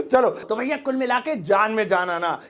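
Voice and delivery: ordinary talk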